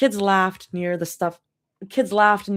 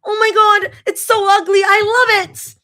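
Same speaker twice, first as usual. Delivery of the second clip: shrill, loud excited shriek; dramatic squeal; 11 dB louder than usual. Retold or imitated. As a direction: squeal over the ugly plush. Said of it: "squeezing it dramatically and going".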